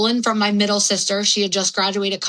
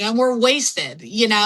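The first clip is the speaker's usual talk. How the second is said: ordinary talk, nothing marked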